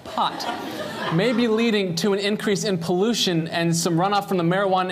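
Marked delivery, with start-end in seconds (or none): 0.1-1.1 "pot, pot" whispered, softer. Shown as a demonstration. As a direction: breathe the word "pot" quietly. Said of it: "not plot".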